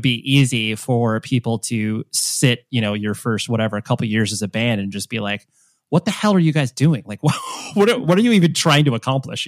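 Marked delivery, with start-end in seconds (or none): 5.9-9.5 mock bewildered. Mock voicing of listeners' confusion.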